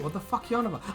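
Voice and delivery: normal talking voice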